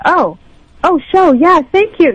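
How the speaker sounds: high, loud startled thanks; suddenly catching on, caught off guard; flustered realization. Retold or imitated. Retelling her own flustered reply.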